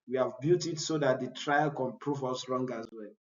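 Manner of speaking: normal talking voice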